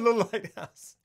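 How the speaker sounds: laughing, slowed title; laughing slow drawl. Pretend. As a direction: draw it out while laughing. Mock dramatic title drawl.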